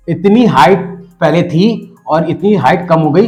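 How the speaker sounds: usual speaking voice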